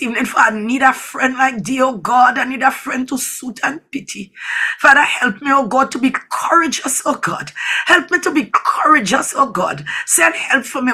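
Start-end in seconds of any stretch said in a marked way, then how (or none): none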